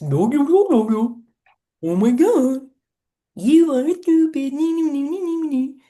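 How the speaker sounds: mock it like a taunting cartoon, nee nee nee. Shown as a demonstration. Guide mocks the inner critic in a cartoon voice, squeaky taunt. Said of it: "give it that cartoon voice".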